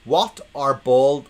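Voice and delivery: loud, slow, put-on posh accent. Joke teller announcing a bald captains gag, in posh voice. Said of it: "I'm doing my posh voice now".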